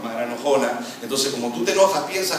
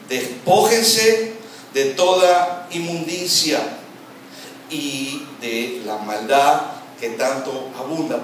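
drawn-out, weighty scripture reading; slow emphatic reading; 3 dB louder, much slower than usual. Shown as a demonstration. Preacher reading a command to the congregation, slow and emphatic. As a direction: stretch each phrase out, pausing between them.

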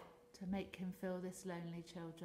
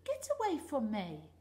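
angry; 4 semitones higher, 9 dB louder than usual. Acted. Rainbow fish rejecting friend.

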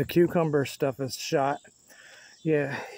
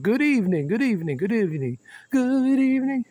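sing-song greeting; 7 semitones higher, 3 dB louder, faster than usual. Pretend.

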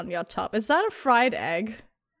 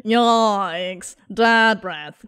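ordinary talk, nothing marked

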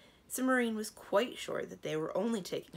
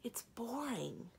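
slow, whispered sulky reply; 5 semitones higher, 4 dB quieter, slower than usual. Acted. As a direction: breathe it out, bored and sulky. Sulky princess answers.

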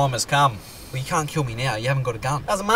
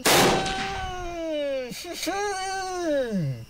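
evil laugh; 11 semitones higher, 6 dB quieter than usual. Acted. Villain finds Norton.

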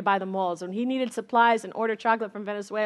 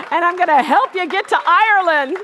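laughing; 7 semitones higher, 9 dB louder than usual. Real, not acted.

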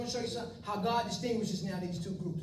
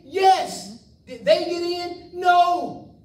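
loud call and response, pushed hard; shouted answers; 6 semitones higher, 11 dB louder, much slower than usual. Real, not acted. Preacher shouting answers to his own questions, loud. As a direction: shout each answer at the crowd.

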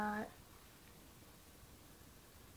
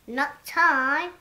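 shouted refusal; 3 semitones higher, 11 dB louder than usual. Acted. An animal refuses loudly.

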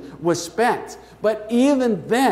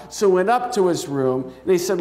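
ordinary talk

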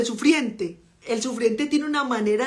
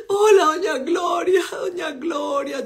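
high, slow tearful greeting; crying friend; 6 semitones higher, 5 dB louder, slower than usual. Retold or imitated. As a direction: wail it through sobs like a heartbroken teen.

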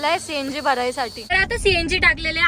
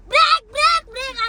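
mock shouting; 9 semitones higher, 4 dB louder than usual. Wife retells husband yelling at a turn, high mock shouting. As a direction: mimic his shout, high and stretched. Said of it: "एवढं ओरडतो?".

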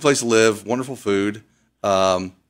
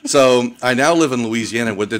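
ordinary delivery

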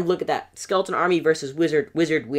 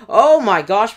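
startled gasp; 6 semitones higher, 11 dB louder, much slower than usual. Real, not acted. Enemy drops Barbarians and Prince.

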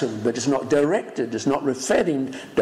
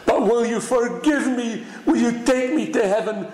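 impassioned preaching; 8 semitones higher than usual. Acted. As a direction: plead to God at full stretch. Preacher voicing a prayer.

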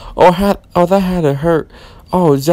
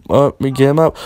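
ordinary delivery

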